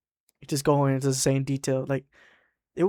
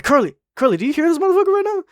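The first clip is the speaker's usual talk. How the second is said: high, loud, fast appeal; urgent calling out; 13 semitones higher, 6 dB louder, faster than usual. Retold or imitated. Worker calling Curly to hear Larry, urgent disbelief. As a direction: call a witness over, incredulous. Calls coworker for help.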